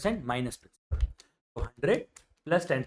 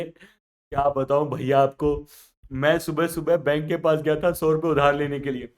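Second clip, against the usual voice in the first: crying; 5 dB louder than usual. Acted. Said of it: "Punjabi तो रो रहा है".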